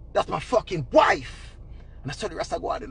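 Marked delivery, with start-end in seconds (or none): 0.1-1.6 slow, lower husband imitation, a touch of menace; lightly voiced jealous husband, slow and low; possessive husband. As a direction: imitate a husband staking his claim. Man's angry claim over wife, imitated.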